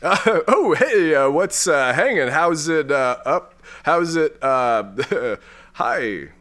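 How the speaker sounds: halting, fumbling hello; awkward greeting. Acted. Smitten space lord answers detective's holocall, awkward. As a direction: fumble for casual words, trailing into nervous laughter.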